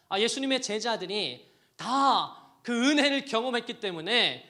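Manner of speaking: loud, high fervent preaching; preaching at full force. Acted. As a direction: drive it home to the congregation. (목청 높여 열정적으로 설교하듯)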